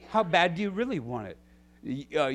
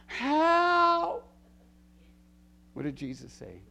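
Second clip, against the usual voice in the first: ordinary talk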